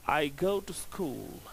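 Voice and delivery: "I go to school" falling at the end on "school"; falling statement tone. Shown as a demonstration. Teacher shows students a statement, falling. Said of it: "the tone is falling".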